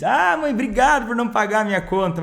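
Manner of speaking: hammed-up resentful sarcasm; sarcastic sulky son. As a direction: play the offended son thanking her bitterly. Son sulking after refusal.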